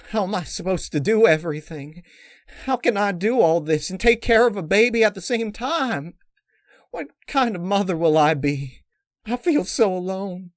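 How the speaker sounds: overwhelmed mare about to cry; tearful distress. A narrator's reading. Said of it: "Her eyes began to well up".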